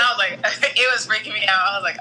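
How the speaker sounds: ordinary delivery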